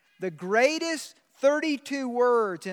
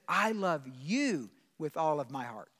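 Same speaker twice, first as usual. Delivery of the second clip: hushed, lower and softer, like God speaking close; tender divine voice; 6 semitones lower, 6 dB quieter than usual. Retold or imitated. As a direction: let it drop to a gentle, intimate hush. God's voice retold, hushed.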